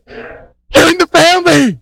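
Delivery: high, drawn-out mock cheer; excited celebration. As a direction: celebrate like a new convert's thrilled friend. Acting out excited welcome.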